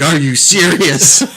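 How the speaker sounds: drawn-out, slow, laughing disbelief; slow, laughing are you serious. Retold or imitated. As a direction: stretch it out slowly in mock shock. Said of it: "I'm like".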